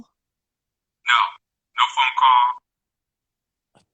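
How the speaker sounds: hollow, slow, echoing bad line; in a tunnel. Real, not acted. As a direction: voice like it's down a tunnel. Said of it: "You sound like you're in a tunnel".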